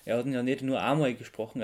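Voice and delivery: usual speaking voice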